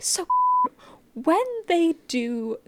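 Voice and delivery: very slow, polite query in a put-on voice; calm respectful question. [name] politely asks the group about the anime, very slow. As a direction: pause the show and ask it very respectfully. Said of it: "very calm and, like, very respectful manner".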